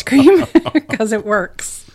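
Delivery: laughing. Real, not acted. Joking about ice cream policy.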